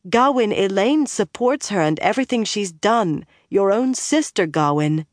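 high, insistent appeal; urgent pleading. A narrator's reading. Min pleads with armed Gawain.

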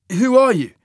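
high, loud challenge; sharp challenge. Acted. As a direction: throw the question at him. Cole confronts the stranger.

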